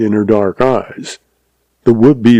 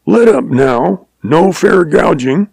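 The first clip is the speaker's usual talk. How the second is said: slow, higher-pitched call, not a full shout; calling out in protest; 7 semitones higher than usual. A narrator's reading. Downed man protesting to the lion, calling out. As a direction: call it out, drawing the words out. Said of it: "He remembered calling out".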